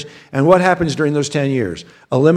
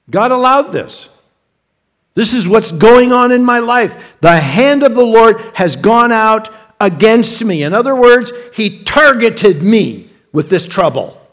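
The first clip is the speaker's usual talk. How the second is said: louder and higher, voicing a hurt believer blaming God; aggrieved complaint; 6 semitones higher, 5 dB louder than usual. Preacher voicing a troubled believer who feels God targeted him, loud and aggrieved. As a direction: let it rise to an indignant protest.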